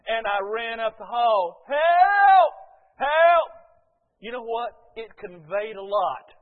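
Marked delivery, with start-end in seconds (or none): 1.7-3.5 high, full-voiced shouts; loud, urgent repeated yell; yelled cries for help. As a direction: holler down the hall for help. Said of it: "following the yells".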